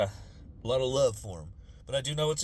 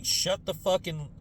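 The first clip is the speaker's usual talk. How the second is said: slow, higher sneering mock; scheming politician; 7 semitones higher, slower than usual. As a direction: drag it out like a cynical official. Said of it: "to be".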